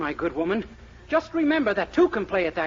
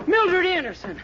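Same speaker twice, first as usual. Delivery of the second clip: indignant outburst; 8 semitones higher than usual. Acted. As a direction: call her name out high and outraged. Hero catches girlfriend on porch.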